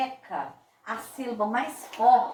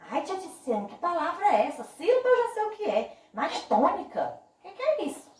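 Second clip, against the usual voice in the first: lightly acted pupil, higher pitch; higher, mock-puzzled questioning; 7 semitones higher than usual. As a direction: play a mildly baffled student questioning teacher.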